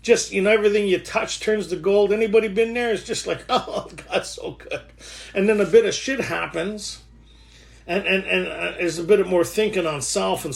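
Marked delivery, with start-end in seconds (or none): 3.5-4.8 soft, slow, airy exhale of mock bliss; blissful sigh. Speaker acts out grateful bliss to the group, blissful sigh. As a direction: sigh it out, mostly breath.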